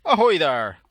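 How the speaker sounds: loud hearty pirate greeting. Pretend. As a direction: hail them like a pirate captain. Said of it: "Bit late for Talk Like a Pirate Day".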